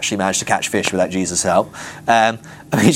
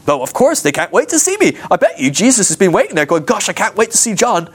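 gleeful self-assurance; 7 semitones higher than usual. Acted. Imagined disciple sure he's adored.

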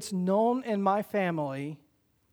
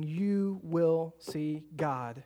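slow emphatic declaration; 2 semitones lower, much slower than usual. Acted. Preaching emphatic line.

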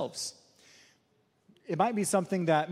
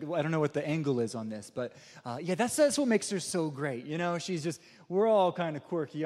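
ordinary delivery

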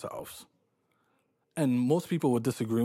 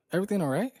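higher-pitched, questioning lift at the end; retold polite check, pitched up; retold casual check-in; 8 semitones higher, much faster than usual. Customer checking on the cashier, retold as a high casual question. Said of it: "that's the way I said it".

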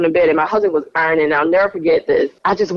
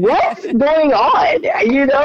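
laughing; 8 semitones higher, slower than usual. Retold or imitated. Joking complaint to God, laughing.

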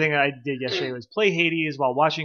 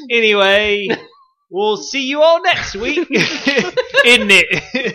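a hammed-up British accent, louder and higher; 7 semitones higher, 6 dB louder, slower than usual. Host signs off to listeners in a mock British accent. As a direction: wrap up the show in a mock British voice. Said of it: "with your British accent".

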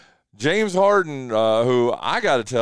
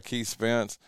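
ordinary talk, nothing marked

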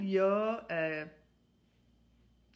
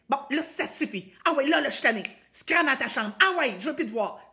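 loud, higher, furious scolding; rejecting angry mom; 6 semitones higher, 10 dB louder than usual. Shown as a demonstration. Mother sending Juliette to her room, loud angry rejection. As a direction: yell at the child to get out. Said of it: "Si je fais ça".

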